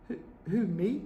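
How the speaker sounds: put-on high, soft stammering girl; timid Matilda. A narrator's reading. Matilda frightened, answering the headmistress. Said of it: "Matilda said".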